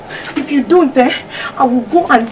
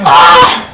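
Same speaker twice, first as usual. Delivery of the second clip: sharp outcry; 11 semitones higher, 6 dB louder than usual. Acted. Wife starts to shout.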